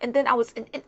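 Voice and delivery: stumbling on words. Shown as a demonstration. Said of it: "they're stumbling on words".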